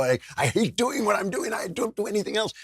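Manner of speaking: high-pitched put-on fed-up voice; mock exasperation. Host voicing someone after hours of games, mock exasperation.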